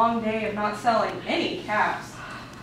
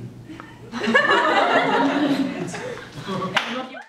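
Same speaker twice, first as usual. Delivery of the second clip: real laugh; 3 semitones higher than usual. Real, not acted. Laughter after story.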